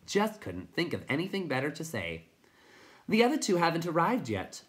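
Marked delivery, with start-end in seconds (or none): 3.1-4.6 high, chirping storybook voice; chirpy little character. Acted. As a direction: chirp proudly, pitched well up. The chirping Tiny keeps talking.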